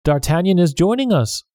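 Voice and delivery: lightly voiced hearty greeting; a jovial friend calling out; mild hearty welcome; cheerful cry. A narrator's reading. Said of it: "Porthos cried".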